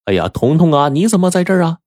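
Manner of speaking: put-on high-pitched surprised cry at finding the child; startled parents. A narrator's reading. Parents spot their child in the room, startled cry. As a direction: call out in rising, startled surprise. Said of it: "就齐声地说".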